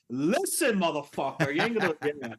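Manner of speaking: put-on loud, tough New York street accent. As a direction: bark it out like a Brooklyn tough guy. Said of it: "in that real New York accent".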